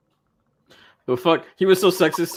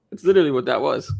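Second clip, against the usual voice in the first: ordinary delivery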